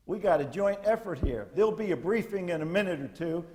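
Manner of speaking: normal talking voice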